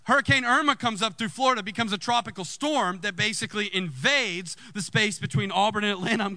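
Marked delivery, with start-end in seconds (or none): none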